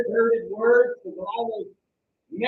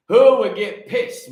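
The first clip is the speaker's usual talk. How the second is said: louder and drawn out, rising; louder, slow challenge to the congregation; louder, slower; 3 semitones higher, 10 dB louder, slower than usual. Shown as a demonstration. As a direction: hurl the question at the crowd. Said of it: "repeated question, punched".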